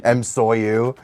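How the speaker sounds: ordinary talk, nothing marked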